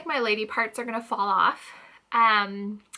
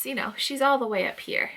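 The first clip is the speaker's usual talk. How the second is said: ordinary talk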